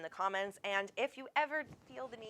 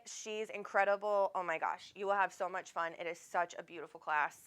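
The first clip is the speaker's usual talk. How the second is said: ordinary delivery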